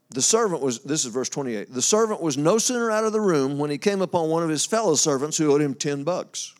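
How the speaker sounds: ordinary talk, nothing marked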